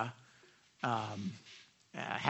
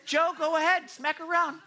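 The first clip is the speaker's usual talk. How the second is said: high, hammed-up pushover voice; mock-helpless high voice; mock meek permission; 11 semitones higher, 4 dB louder than usual. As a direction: squeak out permission like a pushover, played for laughs.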